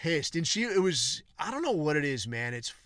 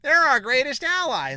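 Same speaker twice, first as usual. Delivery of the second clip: mocking gush, much higher and louder; 11 semitones higher, 9 dB louder than usual. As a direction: ham up an over-the-top gushing fan. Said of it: "people would be like".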